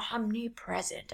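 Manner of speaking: mock ominous, quiet and drawn down; spooky hushed announcer; scary voice. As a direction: say it like a horror trailer. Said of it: "when you say it in that voice".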